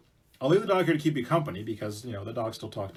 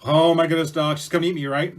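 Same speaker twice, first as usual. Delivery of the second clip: loud, panicky outburst; startled, raised voice; acted alarm; 4 semitones higher, 9 dB louder than usual. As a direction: blurt it out in mock panic. Hunter learns wife will eat him.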